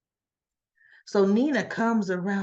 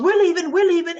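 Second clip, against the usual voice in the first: higher, louder mock urgency; urgent insistence; 7 semitones higher, 6 dB louder, faster than usual. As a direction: act out an insistent exit line. Hurried exit in retold quarrel.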